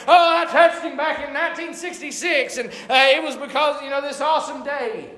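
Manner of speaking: hammed-up boast about a brush with fame; mock bragging admirer. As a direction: play a starstruck name-dropper. Hypothetical admirer bragging in story.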